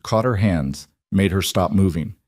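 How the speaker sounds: usual speaking voice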